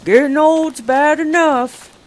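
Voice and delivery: put-on loud, high and slow, old man yelling; shouting. A narrator's reading. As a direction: Garth shouting at Hub about growing old. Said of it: "he shouted".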